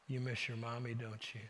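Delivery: very soft, low, gentle question; retold tender father. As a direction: ask it as if comforting a small child. Father tenderly consoles child, retold.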